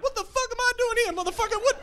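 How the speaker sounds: very high, loud cartoon penguin voice. Penguin complaining about the zoo in a very high squawk. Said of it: "The penguins would be like".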